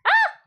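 sharp high yelp of pain. Real, not acted. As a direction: yelp as the heat bites. Burns finger on hot pot.

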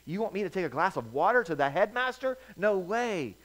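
high, scoffing disbelief; incredulous refusal. Acted. Servant balking at taking water to the headmaster, high and incredulous. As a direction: scoff at the idea.

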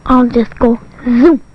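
ordinary delivery

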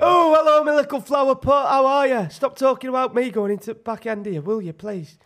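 high, loud Wakefield greeting, easing off toward the end; Mikey the bricklayer. Acted. Bricklayer greets and chides a pal, high Wakefield character voice. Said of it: "Mikey the bricklayer from Wakefield".

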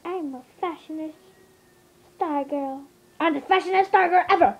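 a hint of a sassy diva; Miss Piggy voice. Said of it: "This is Miss Piggy".

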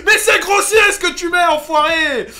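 loud outraged yelling at the screen; angry shout. Real, not acted. Gamer yelling at an opponent, furious shout. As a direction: blast the cheater, full rage. Raging at a cheater in game.

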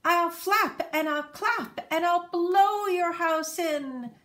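loud, high, slow squawk; a menacing bird hammering each threat; squawking eagle. Acted. Eagle threatening to blow the grass house in, loud squawk. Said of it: "he squawked".

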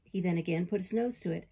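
ordinary delivery

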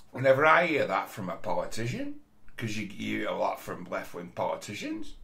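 mock them with a sharp rise on "politician" and "politicians"; mock uptalk. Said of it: "where they put the inflection at the end".

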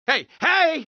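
high sharp yell. Acted. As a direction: shout to stop them. Cabbie yells at someone.

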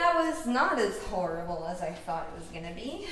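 ordinary talk

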